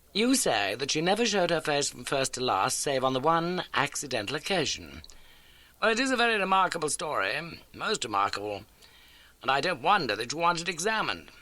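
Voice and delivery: a crisp, brisk detective; Holmes. A narrator's reading. Holmes introduces the landlady to Watson, brisk.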